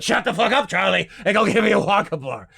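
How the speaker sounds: put-on high, loud, demanding old grandpa whining for candy; cranky grandpa begging for a Wonka bar; Grandpa Joe voice. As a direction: nag like a starving, bossy old man. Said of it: "I find my character voice".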